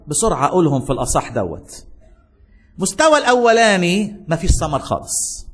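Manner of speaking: ordinary delivery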